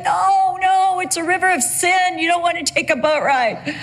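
put-on a sixteen-year-old begging her parents not to go; alarmed teen protest. Teen daughter pleading with dad against the boat ride, alarmed. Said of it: "I'm like".

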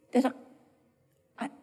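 soft, faltering whisper; tearful whisper. Real, not acted. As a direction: let the words break off in a whisper. Emotional close of sermon.

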